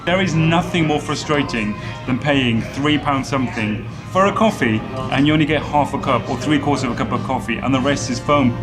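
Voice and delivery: ordinary delivery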